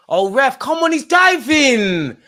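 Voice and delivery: high, loud and slow furious yell at the ref; outraged shout. Real, not acted. Fan yelling at the ref over a dive, furious. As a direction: bellow it in disbelief.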